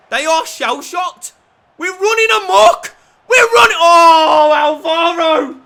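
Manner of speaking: loud, high, drawn-out yelling; ecstatic shout. Real, not acted. Gamer yells as his team runs riot, ecstatic shout. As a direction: bellow with pure joy. Celebrating late goal.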